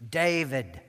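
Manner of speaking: ordinary delivery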